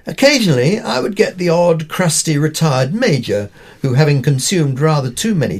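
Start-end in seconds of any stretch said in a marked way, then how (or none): none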